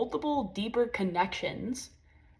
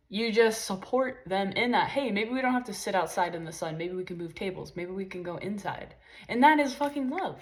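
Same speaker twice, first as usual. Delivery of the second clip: usual speaking voice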